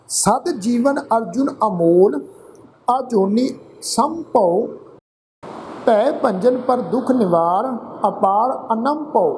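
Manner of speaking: slow, drawn-out chanted reading of the verse; slow scripture recitation. Acted. Teacher reciting scripture to the congregation, slow and chanted. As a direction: intone each line slowly like a hymn.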